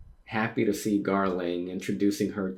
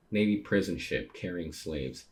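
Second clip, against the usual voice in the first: ordinary delivery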